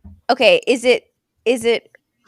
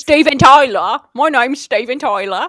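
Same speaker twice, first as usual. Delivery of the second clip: mock British accent; 7 dB louder than usual. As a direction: ham up a British rock star accent. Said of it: "He does not have that accent".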